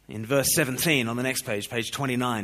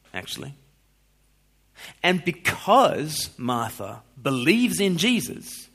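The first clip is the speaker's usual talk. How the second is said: ordinary talk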